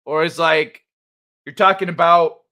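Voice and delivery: ordinary talk, nothing marked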